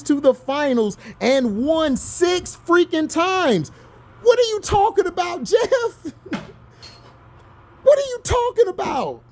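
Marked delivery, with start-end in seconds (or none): 4.2-9.2 pitched way up, incredulous and fed up; exasperated outburst. Real, not acted. Ranting at online fans, exasperated and much higher. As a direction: yell it at the internet in disbelief. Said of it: "I'm so mad".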